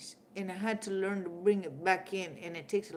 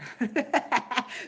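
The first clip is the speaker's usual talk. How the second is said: a burst of high laughter; real laugh; 5 semitones higher, 5 dB louder than usual. Real, not acted. Amused by Chuck's pink hand, a real burst of laughter. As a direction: laugh out at the joke.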